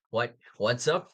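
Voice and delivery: usual speaking voice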